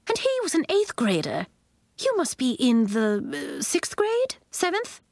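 high-pitched teacher voice, hesitant questions; impressed Miz Fitznickel, guessing. A narrator's reading. Miz Fitznickel guesses Nori's grade, impressed. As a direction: guess upward in a high, wondering voice.

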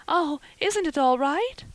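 high, loud anguished question; anguished cry. A narrator's reading. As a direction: plead anxiously, pitched high and rising. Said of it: "cried Anne with a look of anguish".

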